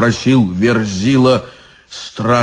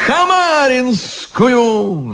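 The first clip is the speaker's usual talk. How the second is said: high, slow whiny demand; capricious; 12 semitones higher, much slower than usual. Acted. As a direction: whine it like a spoilt child. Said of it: "раскапризничался изящный чёрт".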